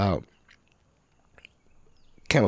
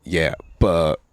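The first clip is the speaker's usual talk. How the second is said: ordinary talk, nothing marked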